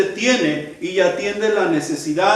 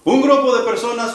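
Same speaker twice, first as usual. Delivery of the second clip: usual speaking voice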